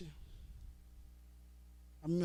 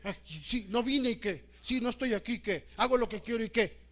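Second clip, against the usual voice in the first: mock defiance; 6 semitones higher, 8 dB louder than usual. Mocking a defiant churchgoer.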